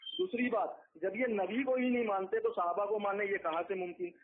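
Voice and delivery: usual speaking voice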